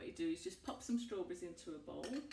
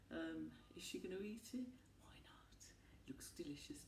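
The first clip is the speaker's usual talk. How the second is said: playful conspiratorial whisper; 5 dB quieter than usual. Real, not acted. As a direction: whisper it like a secret aside. Teasing aside about eating it.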